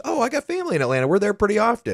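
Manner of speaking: high, quick Southern lady; Southern accent. Retold or imitated. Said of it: "nice Southern accent".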